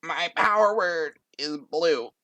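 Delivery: nervous dork's high, wobbly plea; high-pitched short dork on the verge of tears; dorky, near tears. Acted. Timid contestant addressing the judges, high and tearful. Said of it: "on the verge of tears".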